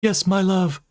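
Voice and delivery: high, doting specter voice; booming ghost. Acted. Ghost answers her beloved dreamily. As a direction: coo it dreamily. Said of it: "the feminine specter's voice boomed".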